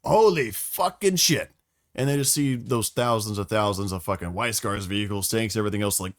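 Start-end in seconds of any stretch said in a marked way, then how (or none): 0.0-1.5 slow, drawn-out awe, high and loud; high, slow stunned holy shit; stunned exclamation. Retold or imitated. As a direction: gasp it out like you can't believe your eyes. Awed exclamation at the sight.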